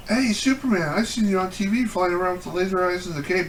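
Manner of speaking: put-on high, eager child voice; excited kid voice. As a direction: play the kid in awe of a hero. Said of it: "the kid goes".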